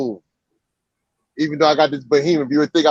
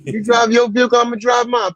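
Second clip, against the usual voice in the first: higher, telling off another driver; annoyed driver; 8 semitones higher than usual. Real, not acted. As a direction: put the other driver in his place.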